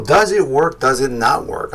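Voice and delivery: ordinary delivery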